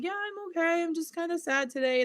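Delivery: retold gentle admission to a small child; teacher reassuring children. Acts out her reply to children.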